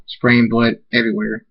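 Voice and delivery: ordinary delivery, nothing marked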